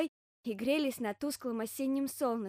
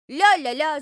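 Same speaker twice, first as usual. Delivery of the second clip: very loud, higher la-la holler; bear cub's yell; 5 semitones higher, 14 dB louder, slower than usual. Acted. Bear cub scrambling back up, loud gleeful yell. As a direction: belt out the nonsense syllables. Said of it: "завопил медвежонок".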